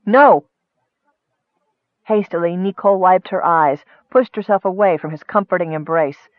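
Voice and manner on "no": loud, higher outburst; sharp refusal. A narrator's reading. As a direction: refuse with sudden force. Woman abruptly refuses to leave.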